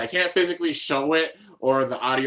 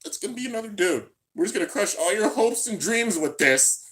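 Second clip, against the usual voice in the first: mocking the studio; 8 semitones higher than usual. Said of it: "they're just like".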